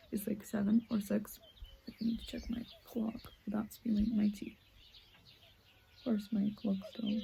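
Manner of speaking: soft unvoiced whisper, not to wake anyone; early morning whisper. Real, not acted. Alone at dawn with tea, telling viewers the time: hushed whisper. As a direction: breathe the words quietly, no voice.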